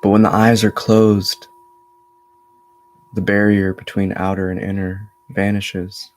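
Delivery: measured meditation voice with long pauses; steady, level tone, resting between phrases; guided meditation. Acted. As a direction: guide them inward in an even, unhurried flow. Leads a meditation.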